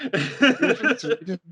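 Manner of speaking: high, slow, laughing band impersonation; mock metal band. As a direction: draw it out high, through laughter.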